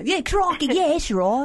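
high, hammed-up Australian accent; excitable mock Australian accent; Crocodile Hunter. Shown as a demonstration. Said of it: "Everybody does Crocodile Hunter".

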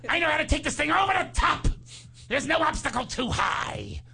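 swagger like a twenties gangster; hammed-up Cagney impression. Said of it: "Are you Cagney?".

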